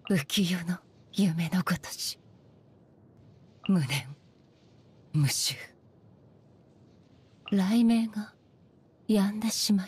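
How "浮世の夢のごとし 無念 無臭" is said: low, solemn warrior's pronouncement; short phrases intoned low and measured; grim battle resolve. Acted. Warrior vowing to cut down evil, grim and low.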